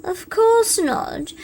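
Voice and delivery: usual speaking voice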